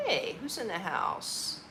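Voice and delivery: ordinary delivery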